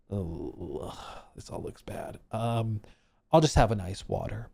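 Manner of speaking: quiet, low grumble to yourself; unimpressed diner. Retold or imitated. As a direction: murmur it with hushed reluctance. Retold reluctant look at menu.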